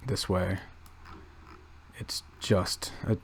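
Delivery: ordinary talk, nothing marked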